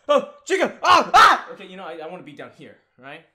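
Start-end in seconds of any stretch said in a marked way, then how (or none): 0.1-1.5 much higher and louder, a sudden scared yell; startled shout. Real, not acted. Gamer spotting Chica on screen, a real startled shout. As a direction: jolt and cry out at the scare.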